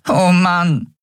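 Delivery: high sighing little voice; despairing fairy. Acted. Nelen giving up, high despairing sigh. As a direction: groan in despair.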